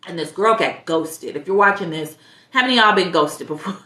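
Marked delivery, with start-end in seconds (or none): none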